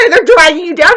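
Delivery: high, loud laughing cry; real laugh bursting through the complaint; laughing outburst. Real, not acted. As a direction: break into a shrieking laugh. Laughing outburst mid rant.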